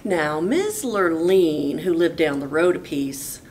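plain reading voice

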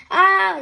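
a sharp pained yelp. A narrator's reading. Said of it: "yelped Lazy Linda".

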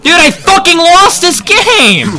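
high, drawn-out shout of frustration; real exasperated outburst; despairing gamer yell. Real, not acted. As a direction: howl it slowly, sure the game is lost. Despairing at losing the game.

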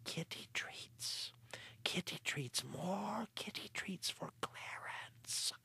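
slow hushed unvoiced chant; the cat whispering to the sleeping farmer. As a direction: breathe it into a sleeper's ear. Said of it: "And if I whisper".

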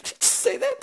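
very high, soft questioning; incredulous aside. Retold or imitated. Stunned customer questioning what he heard, high mock disbelief. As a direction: squeak it quietly in mock disbelief. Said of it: "and I'm like".

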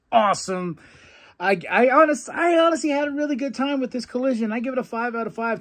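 fast, high, hyped wrap-up; excited verdict. Real, not acted. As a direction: rave about the show like a fan. Enthusiastic final verdict.

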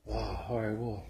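usual speaking voice

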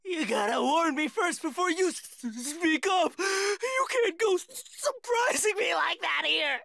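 stammering, shaken protest; gasping, shivering fright. Acted. Coward scolding the boy for surprising him, stammering in fright. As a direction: catch on your words, badly startled. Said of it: "gasping, and shivering really badly".

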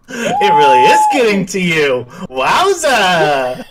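laughing through the words; high, loud laughing. Real, not acted. Laughing fit after mishap.

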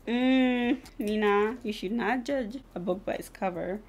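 ordinary delivery

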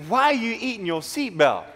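put-on high, laughing puzzled girl; a stranger baffled, half laughing. Girl in car staring.

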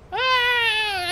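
high sharp cry of dismay. Real, not acted. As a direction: yelp as you fall. Misses the platform.

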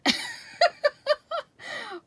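squealing fond laugh; high burst of laughter. Real, not acted. Laughing about son cheating at game.

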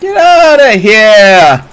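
high, drawn-out yell falling away; loud protest. Real, not acted. Streamer shooing an opponent, loud protest.

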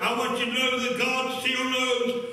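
high, driving scripture proclamation; full-force preaching. Real, not acted.

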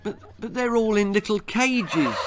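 high, stammering protest; dismayed boy. Acted. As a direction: protest in an upset child's voice.

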